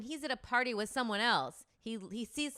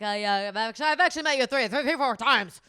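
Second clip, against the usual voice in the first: much louder, higher, tight fake-polite annoyance; mock angry man; 4 semitones higher, 12 dB louder than usual. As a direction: scold her while pretending to be nice. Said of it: "That, like, angry".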